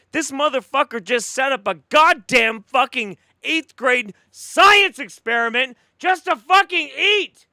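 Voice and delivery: loud, high-pitched furious rant; real outrage, hammering the swear words; losing his mind. Real, not acted. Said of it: "I feel like I'm losing my fucking mind".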